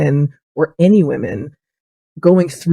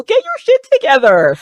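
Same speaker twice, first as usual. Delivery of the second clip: exasperated scolding. Acted. Scolding an absent father of children.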